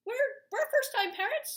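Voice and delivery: high, loud, laughing exclaim; giddy amused outburst. Real, not acted. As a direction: blurt it out, amused. Laughing at husband's spreadsheet.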